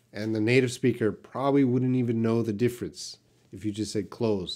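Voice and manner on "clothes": "clothes" said like the verb "close"; "clothes" simplified to "close"; cheat pronunciation. Shown as a demonstration. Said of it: "C L O S E".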